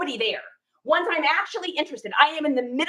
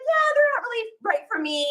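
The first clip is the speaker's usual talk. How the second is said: higher, awkwardly polite no-thanks; higher, nervous polite refusal; polite brush-off; 9 semitones higher than usual. Retold or imitated. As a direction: decline sweetly to escape. Politely declining pushy seller.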